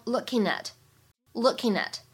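"looking at" run together, slow; slow demo of "looking at" linked. Shown as a demonstration. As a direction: join looking and at. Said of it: "可以做连读".

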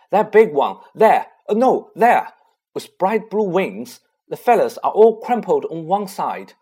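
loud, eager shouting; excited calling. A narrator's reading. Ron calling up to the others about the key, excited shout. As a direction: yell it out, spotting the key. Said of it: "he called to the others".

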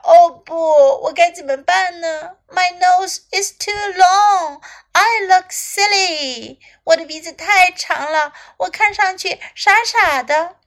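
mild mock wail of dismay; crying. A narrator's reading. Little elephant upset about its long nose, mock crying. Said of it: "小象哭道".

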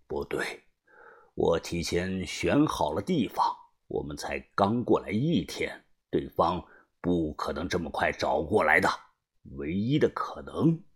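put-on low, hushed, deliberate older leader; frowning gang boss. A narrator's reading. Leader reasons out the betrayal, low and grave. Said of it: "他皱着眉说道".

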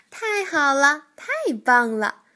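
loud, happy cheer. A narrator's reading. 跳跳听说明天上飞行课，高声欢呼. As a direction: cheer like a thrilled child. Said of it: "跳跳欢呼起来".

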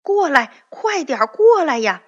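high, loud shout to the others; loud call. Acted. Tin man shouting to his companions to hurry across, loud high call. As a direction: call them over at full voice. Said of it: "大声喊着说".

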